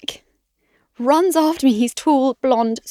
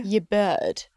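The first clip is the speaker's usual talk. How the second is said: mock your bird; 3 semitones lower, 5 dB quieter than usual. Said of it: "I hate it when people go".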